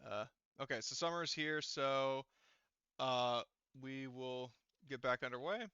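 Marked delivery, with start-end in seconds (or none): none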